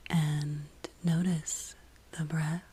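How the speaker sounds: guided meditation. Real, not acted. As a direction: guide them inward, lingering on each phrase.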